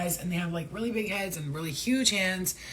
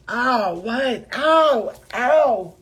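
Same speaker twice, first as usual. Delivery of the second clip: sharp yelps, much louder and higher; real pain cry; 5 semitones higher, 11 dB louder, much slower than usual. Real, not acted. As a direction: cry out as you're struck. Being hit, cries out in pain.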